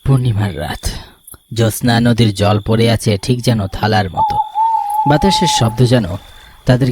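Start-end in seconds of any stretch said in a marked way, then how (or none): none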